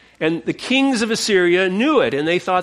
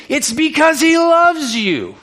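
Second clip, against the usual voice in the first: louder, higher, slowed emphasis; impassioned; 8 semitones higher, 5 dB louder than usual. Real, not acted. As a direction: press it home with passion. Preacher rising to climax.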